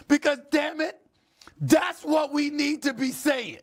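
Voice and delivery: passionate shout. Real, not acted. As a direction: yell it from the gut, fast at the end. Emotional host erupts in support.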